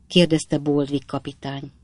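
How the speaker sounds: ordinary delivery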